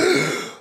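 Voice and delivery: high, sudden gasp back to life; sharp gasp. Acted. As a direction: gasp in as you wake up. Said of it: "erwachte Robert mit einem Keuchen zum Leben".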